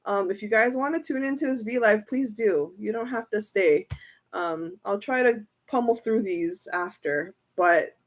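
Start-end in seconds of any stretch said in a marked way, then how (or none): none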